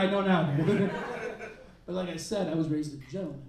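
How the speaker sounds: ordinary talk, nothing marked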